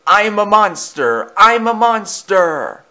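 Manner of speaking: high, slow taunting shout; mock monster shout. A narrator's reading. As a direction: draw it out, teasing with a fake scare. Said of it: "he shouted".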